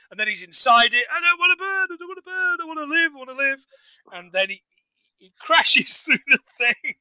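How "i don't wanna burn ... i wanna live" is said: high, wailing mock panic, pleading for life; hammed-up panic, begging not to burn; mock terrified plea. Film fan acting out the man in the casket, mock terrified wail.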